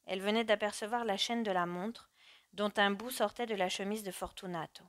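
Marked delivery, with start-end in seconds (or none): none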